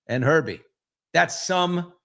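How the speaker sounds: ordinary talk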